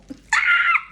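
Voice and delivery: sudden squealing burst of laughter; a loud gleeful squeal of laughter; high shrieking laugh. Real, not acted. Shrieking laugh at own bit.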